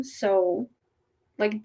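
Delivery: ordinary talk, nothing marked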